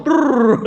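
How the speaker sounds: loud playful brrr; brrr running noise. Retold or imitated. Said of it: "lari langsung".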